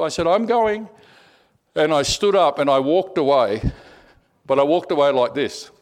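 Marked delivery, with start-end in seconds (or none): 0.3-0.9 insistent retort. Retold or imitated. Insisting on leaving during quarrel.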